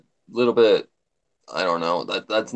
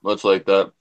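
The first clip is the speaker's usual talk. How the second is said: normal talking voice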